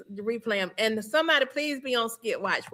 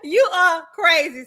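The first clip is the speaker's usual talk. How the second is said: laughing; 6 semitones higher, 6 dB louder than usual. Real, not acted. Laughing at chat's spelling joke.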